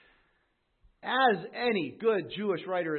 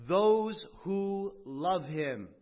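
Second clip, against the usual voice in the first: tender quote; 2 semitones higher, much slower than usual. Acted. Preacher savoring the quoted words slowly. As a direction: let the phrase linger lovingly.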